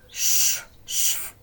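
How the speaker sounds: unvoiced loud shh noises; rustling shh effect; shushing sound. A narrator's reading. Sound effect in read story.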